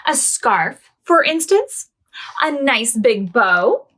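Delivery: gentle storybook reading to little ones; reading to children. A narrator's reading. Storyteller reading to children, gently. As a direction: read it like a bedtime picture book. Reads picture book aloud.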